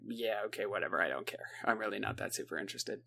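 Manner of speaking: soft, low-energy shrug of a voice; bored brush-off. Retold or imitated. Max brushing off injured Stevie.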